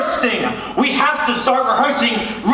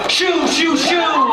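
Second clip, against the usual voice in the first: higher shouted shoo, shoo; 6 semitones higher, much slower than usual. Acted. Director shoos the idle cast away, shouting. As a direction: wave them off with a shout.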